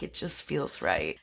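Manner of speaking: low, soft put-on voice; low mock reply. Character answering a question about his grenade, low and soft. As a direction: drop it low and quiet. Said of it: "And he's like".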